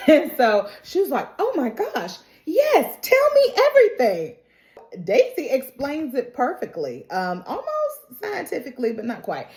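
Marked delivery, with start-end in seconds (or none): 1.4-4.4 imitating a thrilled, high-pitched eager friend; eager excitement. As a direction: gush it, voice leaping way up. Shu eagerly asks about sex.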